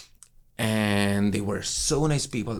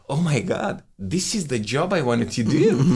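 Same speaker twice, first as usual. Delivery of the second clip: higher and louder, thrilled; retold rapture at discovering his dream job; thrilled realization; 6 semitones higher, 6 dB louder than usual. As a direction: burst out with joy at finding your calling. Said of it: "I was like".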